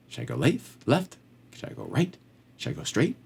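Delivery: quiet, rising questions to the Lord; soft asking prayer. Pretend. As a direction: ask gently which way to turn. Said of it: "Just say".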